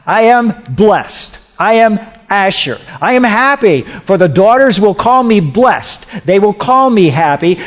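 high, joyful declaration in quoted words; joyful quote, ringing out high; happy exclamation. Retold or imitated. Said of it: "she said, I'm happy".

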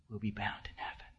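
a secret told softly; hushed whisper. Real, not acted. Preacher whispering the verse to the congregation. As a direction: breathe the words in a whisper.

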